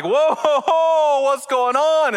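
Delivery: high startled whoa, ho, ho; a crowd caught off guard, voices jumping up; anxious crowd. Acted. Crowd reacts to the failed curse, high startled exclamations. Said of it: "It's like".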